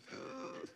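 high, quick intake of breath; sharp gasp. Retold or imitated. Preacher reacting to a claim that bothers him, gasping. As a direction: gasp in objection.

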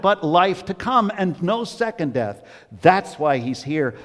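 impassioned sermon peak; preaching. Acted. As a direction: drive the point home with fervor. Preaching climax.

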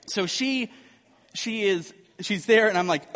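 normal talking voice

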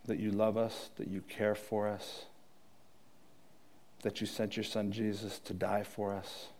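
soft, intimate closing prayer, trailing low; quiet prayer. Real, not acted. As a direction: pray quietly as if alone with God. Closing prayer over music.

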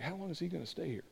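very soft, quick grumble to a companion; muttered aside. Acted. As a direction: complain under your breath, hurried.

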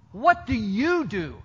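slow question leaning hard on "you"; stress on YOU. Shown as a demonstration. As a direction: draw out "What do you do?", underlining "you". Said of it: "underline the word "you" with your voice".

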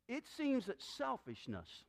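ordinary talk, nothing marked

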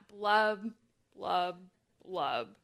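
slow blubs sinking low; gurgling fish man croak. Acted. Echoes the fish's blub.

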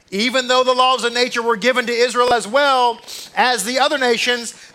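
loud, high, slowed preaching with punch; preaching at full force. Acted. Rabbi preaching to his congregation about miracles, loud and driving. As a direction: proclaim it to the whole room.

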